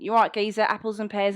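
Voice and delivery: hammed-up film Cockney. Londoner mocking how outsiders think they talk, stagey Cockney accent.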